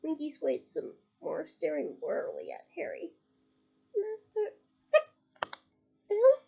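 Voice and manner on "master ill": high, pleading house-elf voice; a tiny tipsy elf fretting over her master; high elf squeak; squeaky elf. A narrator's reading. Winky hears master is ill.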